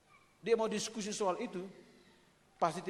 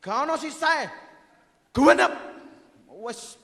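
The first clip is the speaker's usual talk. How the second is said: loud high Javanese burst, genep hit hardest; emphatic Javanese; 6 semitones higher, 12 dB louder than usual. Shown as a demonstration. Preacher exclaiming in Javanese that nothing is left. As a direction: shout it out in Javanese, full of conviction. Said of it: "kalau orang Jawa lebih mantap".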